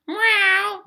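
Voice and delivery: highest mock meow; cat meow. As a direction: squeal it like a cartoon cat. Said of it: "They're like".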